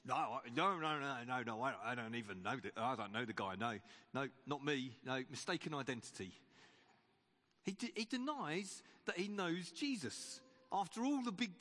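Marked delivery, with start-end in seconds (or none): 0.1-6.3 lower, hurried flustered denials; panicked denial. Acted. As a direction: babble your way out of it, voice dropped low. Peter panics, denying Jesus.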